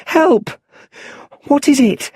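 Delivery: high, loud, scared call; a boy in the dark hearing strange sounds; little Bruce alone in the well, calling out high and loud in fear; frightened child. A narrator's reading. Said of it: "He isn't alone in the well".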